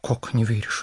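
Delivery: ordinary delivery, nothing marked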